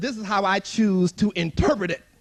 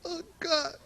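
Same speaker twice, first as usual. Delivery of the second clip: laughing dread; 6 semitones higher, 15 dB quieter than usual. Retold or imitated. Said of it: "and I'm like".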